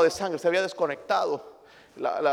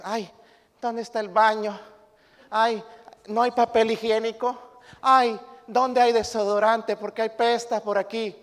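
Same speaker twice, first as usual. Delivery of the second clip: mock fussy complaint; 5 semitones higher than usual. Imitating spoiled visitors complaining.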